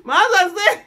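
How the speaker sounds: high, loud, words broken by laughter; laughing. Real, not acted. Wife retelling husband's joke, laughing. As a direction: tell it while cracking up.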